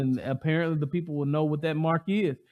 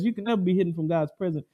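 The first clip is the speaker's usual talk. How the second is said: ordinary talk, nothing marked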